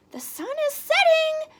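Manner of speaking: high, loud warning chirp; warning bird. Acted. Flitter warns the others, high loud chirp. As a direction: warn the others like a little bird. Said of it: "warned Flitter".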